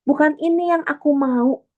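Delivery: petulant complaint. Pretend. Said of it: "gitu kan".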